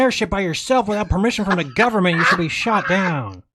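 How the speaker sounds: a sneering law-and-order citizen justifying the shootdown; mocking statist. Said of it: "statists would just be like".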